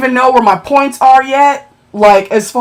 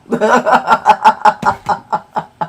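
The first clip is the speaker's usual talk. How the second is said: laughing. Real, not acted. Streamer laughs telling viewers about her ten-dollar find. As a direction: chuckle at the bargain.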